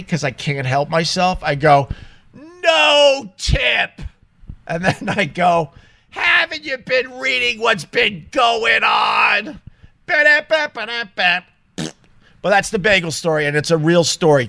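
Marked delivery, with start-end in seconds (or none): 2.3-4.0 high, drawn-out retold shout; very loud refusal. Customer answering the cashier who wanted a tip, loud. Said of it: "I said very loudly". 6.1-9.6 high, slow, taunting question; mocking retort. Customer throwing cashier's line back, mocking. 10.1-11.4 high-pitched nonsense syllables; mocking babble. Customer jeering at the cashier, mocking babble.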